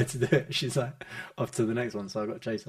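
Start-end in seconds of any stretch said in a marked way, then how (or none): none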